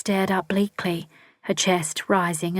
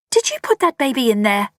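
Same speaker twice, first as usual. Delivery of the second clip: higher and louder accusing question; demanding; 6 semitones higher, 5 dB louder than usual. A narrator's reading. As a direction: confront him hard. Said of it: "she demanded".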